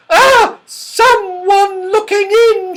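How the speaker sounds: very high, loud shriek, then drawn-out cry; scream of alarm. A narrator's reading. Goldilocks sees a face at the window, screaming. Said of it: "Goldilocks gave a scream".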